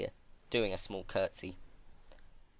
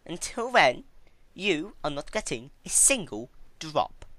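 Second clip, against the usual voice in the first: higher, louder, condescending deal; Twilight bargaining; 8 semitones higher, 4 dB louder than usual. Acted. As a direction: talk down to her like a governess. Twilight refusing soup.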